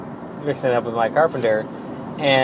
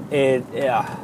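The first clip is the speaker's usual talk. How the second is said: ordinary talk, nothing marked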